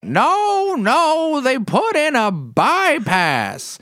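put-on very high, loud, slow cranky old man; Grandpa Bob impression. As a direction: shout it like a stubborn old grandpa. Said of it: "Can you do your Grandpa Bob for us".

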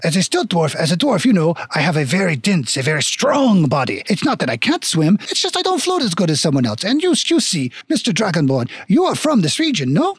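proud stilt dwarf, swooping French lilt; hammy mock-French explanation; bad French accent. Stilt dwarf explains himself to the dragonborn, bad French accent. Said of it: "very shitty French accent".

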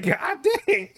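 high laughing "God dang"; amazed and laughing; laughing exclamation. Retold or imitated. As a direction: crack up in amazement. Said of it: "I'm like".

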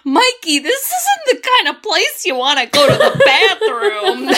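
high, laughing warning; kid warning voice. Retold or imitated. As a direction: warn him like a spooked kid. Voicing Chunk warning Mikey.

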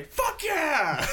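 high-pitched answering whoop; imitated group holler. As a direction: yell it back like a whole crowd. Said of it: "we kept hollering out".

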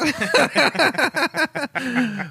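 high laugh at a dad joke; laughing at the corny joke. Real, not acted. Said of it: "That's such a dad joke".

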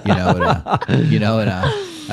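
words breaking up with real laughter, pitch jumping high; laughing. Real, not acted. Guest cracking himself up telling the hosts a story, laughing. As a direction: laugh your way through the stumble.

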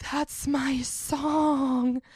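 slow, sulky, possessive drawl of a claim; drawn-out claim. Retold or imitated. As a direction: stretch every word out very slowly. Claiming the burrito song.

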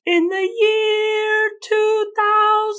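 very high, slow, stretched robot singsong. Pretend. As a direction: intone it like a cheesy robot. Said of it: "We are robots".

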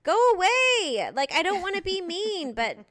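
drawn-out shout at a pest; fed-up brush-off. Retold or imitated. Woman telling a clingy dancer to leave, exasperated loud. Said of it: "I'm like".

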